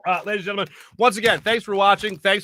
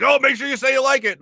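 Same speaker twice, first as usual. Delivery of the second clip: mock salesman; 9 semitones higher than usual. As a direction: ham up a pushy sales rep coaxing him. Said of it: "a whole sales team behind me saying".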